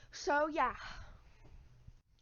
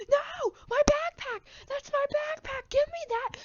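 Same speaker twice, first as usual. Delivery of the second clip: put-on very high, loud shrieking kid voice; panicked kid; 13 semitones higher, 6 dB louder than usual. Harold wailing at the garbage truck, shrill high panic. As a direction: shriek like a little kid losing his stuff.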